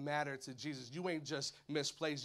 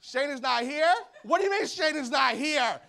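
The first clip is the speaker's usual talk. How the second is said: high, loud disbelief; Jesus missing someone he loves; alarmed Jesus; 10 semitones higher, 10 dB louder than usual. Acted. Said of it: "Jesus is like".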